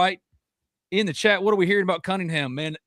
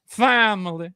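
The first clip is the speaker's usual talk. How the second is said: higher, punchier "Family" in a light Brian Kelly imitation; Brian Kelly catchphrase; 5 semitones higher, slower than usual. Host repeats Brian Kelly's catchphrase to tease him, higher light imitation. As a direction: toss off "Family" a bit higher, mocking the coach. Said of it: "his voice sounds... it's just grating".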